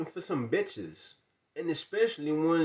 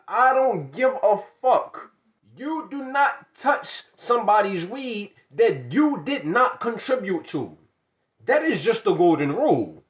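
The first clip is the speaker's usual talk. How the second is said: louder, slow, emphatic; heated rant; 6 semitones higher, 9 dB louder than usual. Real, not acted. Telling the audience the weed rule, heated. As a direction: lay down the law.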